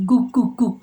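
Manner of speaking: imitating a dog's bark in a teacher's voice; lightly imitated woofs. Shown as a demonstration. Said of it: "menirukan suara anjing". (menirukan gonggongan anjing)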